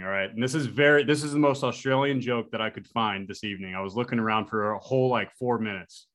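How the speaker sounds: usual speaking voice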